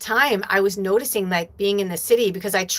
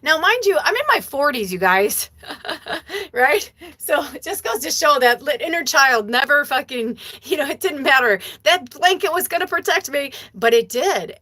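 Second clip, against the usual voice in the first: amused; 8 semitones higher, 3 dB louder than usual. Real, not acted. Laughing at her own childish fear.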